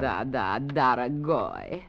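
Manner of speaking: low, slow purr in a put-on Russian accent; sultry fake Russian accent to lure the general. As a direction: coo the Russian endearment slowly, as the Russian lady. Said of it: "Is Grushenka".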